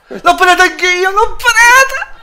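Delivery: high excited yelling; triumphant shout. Real, not acted. Gloating shout over saved penalty. (urlo trionfante)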